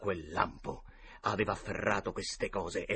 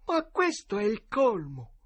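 perplessità stizzita; 11 semitones higher, 4 dB louder than usual. A narrator's reading. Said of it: "si grattò la testa con aria perplessa".

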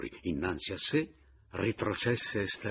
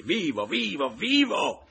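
high, loud, exultant shouts; ferocious triumphant cry; fierce joy; 12 semitones higher, 9 dB louder, slower than usual. A narrator's reading. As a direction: crow it savagely, climbing higher. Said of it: "con gioia feroce".

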